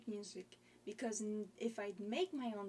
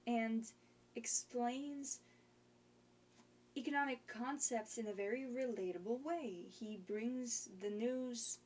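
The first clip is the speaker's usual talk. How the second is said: ordinary talk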